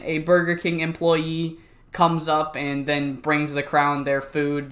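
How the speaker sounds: usual speaking voice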